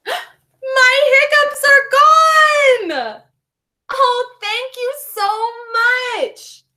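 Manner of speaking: high, slow delighted cry; hiccups gone joy. Acted. Penguin thanking the kids, overjoyed. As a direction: burst out with relief.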